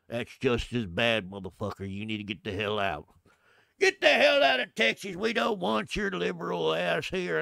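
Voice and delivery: a hammed-up hostile Texan yelling. Locals chasing the moderate out of Texas, shouting. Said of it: "they immediately go".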